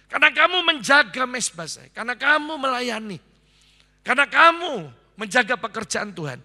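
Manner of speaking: loud, rising promise with "kamu" struck each time; preached promise. Acted. Preacher voicing Jesus to the pastor's wife, loud and fervent. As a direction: proclaim Jesus's words over the pastor's wife.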